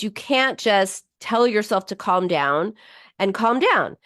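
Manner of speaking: ordinary delivery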